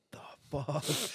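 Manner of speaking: slow shocked whisper. Retold or imitated. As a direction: breathe it out in disbelief. Shocked aside at fake ID.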